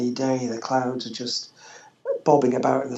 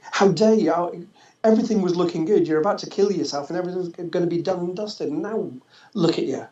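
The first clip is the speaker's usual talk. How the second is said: higher, rushed furious scolding; enraged inner voice; 5 semitones higher, faster than usual. Retold or imitated. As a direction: berate yourself like a furious inner voice. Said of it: "there was this rage".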